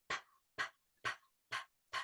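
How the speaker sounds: soft, voiceless "p" puffs; letter P sound. Shown as a demonstration. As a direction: repeat "p" as little bursts of air. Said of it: "sound like a puff of air".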